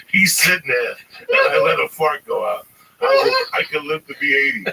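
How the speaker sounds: ordinary talk, nothing marked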